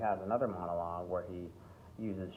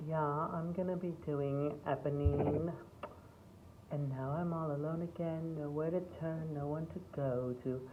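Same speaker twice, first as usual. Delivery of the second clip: put-on auditioning girl; 8 semitones higher than usual. Girl at a musical audition announcing Eponine, mock girlish. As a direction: imitate an earnest musical-theatre hopeful, pitched way up. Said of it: "the girls come in and they're like".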